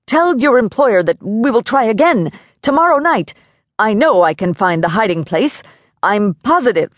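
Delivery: high-pitched, urgent pleading; pleading babble. A narrator's reading. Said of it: "Mister Johnson quit babbling".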